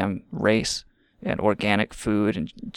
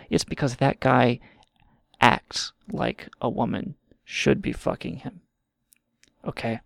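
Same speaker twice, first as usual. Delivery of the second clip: ordinary delivery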